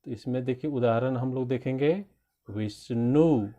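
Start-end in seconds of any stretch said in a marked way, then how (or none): 2.5-3.5 Vishnu with weight on its last syllable; stressed syllable. Shown as a demonstration. Teacher showing children syllable stress, stressed final syllable in Vishnu. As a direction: lean on the end of Vishnu. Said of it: "पे ज़्यादा जोर दे रहे हैं".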